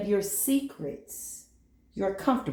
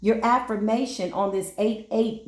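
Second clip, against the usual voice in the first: normal talking voice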